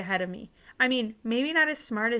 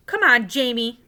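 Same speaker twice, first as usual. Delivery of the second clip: angry; 9 dB louder than usual. A narrator's reading. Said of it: "said Mom angrily".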